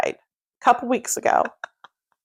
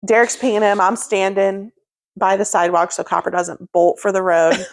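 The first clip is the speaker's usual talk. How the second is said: normal talking voice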